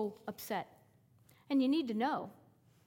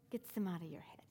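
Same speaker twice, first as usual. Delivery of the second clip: softer, lower whisper; preacher dropping to a hush; 5 semitones lower, 8 dB quieter, faster than usual. Acted. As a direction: let it fall to a whisper. Soft drop after pause.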